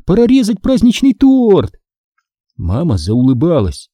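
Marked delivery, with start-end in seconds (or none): 0.0-1.8 high, excited announcement; joyful exclamation. A narrator's reading. Daughter eager for the festive cake, joyful. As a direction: announce it gleefully, pitched up. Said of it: "радостно воскликнула я".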